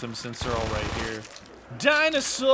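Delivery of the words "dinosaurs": shout. Real, not acted. Calls out while filling dinosaur.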